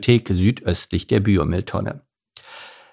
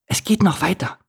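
whisper-ish urging to listen; high character voice, held down; hushed Steffi voice; 9 semitones higher than usual. Acted. Steffi shushes Philipp.

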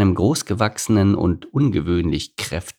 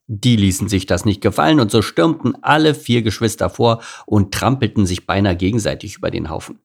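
plain reading voice

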